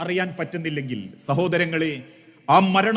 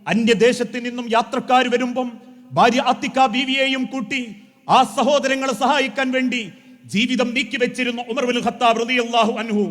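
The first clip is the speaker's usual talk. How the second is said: ordinary talk, nothing marked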